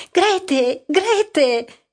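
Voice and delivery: anxious shout for Grete; calling out. A narrator's reading. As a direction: call the daughter through the house. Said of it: "gridò poi".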